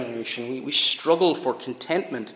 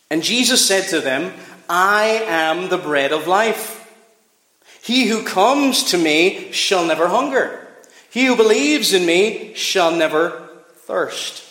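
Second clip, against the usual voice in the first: scripture reading; 3 semitones higher, 4 dB louder than usual. Acted. Preacher reads Jesus' words to the church, solemn and raised. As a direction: proclaim the words of Jesus to the congregation.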